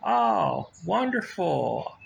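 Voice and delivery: bright smiling customer hello; smile in your voice. Shown as a demonstration. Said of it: "speak with a smile in your voice".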